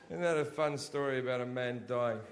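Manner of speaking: normal talking voice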